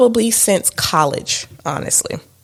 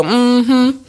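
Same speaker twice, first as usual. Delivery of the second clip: a light, higher mock "Mm-hmm"; imitating husband; 7 semitones higher than usual. Wife teasingly copies husband's knowing hum, lightly higher. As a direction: tease him by copying his hum. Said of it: "I heard you go".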